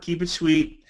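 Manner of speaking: normal talking voice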